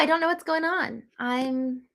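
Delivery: normal talking voice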